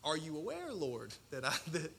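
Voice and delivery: ordinary talk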